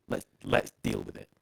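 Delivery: glitchy robotic voice, pitch dropping out. Real, not acted. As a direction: digital robot voice over a bad line. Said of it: "your voice just went robotic for a brief moment".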